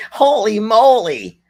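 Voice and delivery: high, loud "Holy moly" cry; loud exclamation. Pretend. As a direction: belt out "Holy moly" in mock shock. Repeated exclamation, louder.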